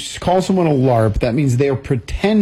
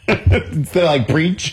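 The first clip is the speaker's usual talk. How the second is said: ordinary talk, nothing marked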